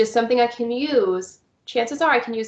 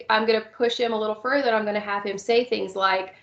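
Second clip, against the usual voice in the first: ordinary talk, nothing marked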